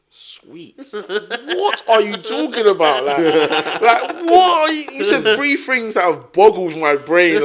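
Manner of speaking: mock bewildered cousin; laughing incredulous outburst; baffled disbelief. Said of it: "cousin confused".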